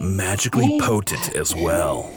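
low infomercial announcer voice; hard sell ad. Acted. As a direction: sell it like a cheesy late-night ad. Parody ad with music.

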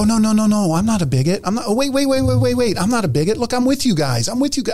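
high, pleading scramble to take it back; hammed-up anxious appeasing; mock backpedalling. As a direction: plead your innocence in mock panic. Mock backpedalling to avoid bigot label.

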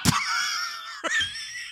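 high, breathy burst of laughter; real laughing fit; shrieking laugh. Real, not acted. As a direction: laugh at the absurdity. Laughing at own story.

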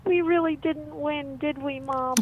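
put-on slow, high small-child voice; upset little boy. Little boy asks his mom about the tug of war, upset. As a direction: ask Mom like a crestfallen little kid. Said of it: "this one little boy".

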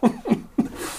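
high, soft laughter. Real, not acted. Amused at the cereal bowl gift, a soft high laugh.

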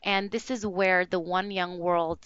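ordinary talk

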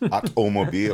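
Automobile said as a mock Austrian "ahkomobil"; Schwarzenegger accent. Host jokes the Irish word with an Austrian accent. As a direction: Automobile with a thick Austrian accent, tongue in cheek. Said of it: "That sounded a little bit like Schwarzenegger".

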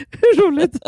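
helpless laughter at own joke; words squeezed out high through laughter; laughing. Real, not acted. Cracking up retelling a joke to a friend, laughing.

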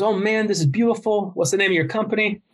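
a hint of Tyson, delighted; Mike Tyson voice. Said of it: "he goes".

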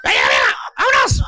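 shout. Retold or imitated. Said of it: "le grita a la señora".

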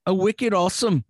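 bad Irish accent. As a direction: do a badly faked Irish lilt. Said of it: "that was an Irish thing".

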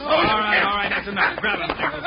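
loud, high commanding shout; breaking up a fight. Acted. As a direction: bark orders to stop the fight.

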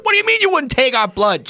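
high, quick mock outrage; indignant protest. Guy at the back objects to refused blood, indignantly. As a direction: imitate an affronted man calling from the back.

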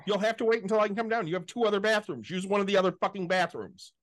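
high, fast, exasperated rant at mom; retold angry outburst. Son snapping at his mother about the toilet, exasperated rant. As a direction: vent like you're yelling at your mother over the phone. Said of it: "I'm like".

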